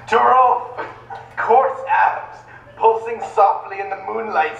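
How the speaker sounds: mock-serious romance novel narration; hammed-up audiobook narrator; dramatic reading. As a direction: read the steamy prose with straight-faced drama.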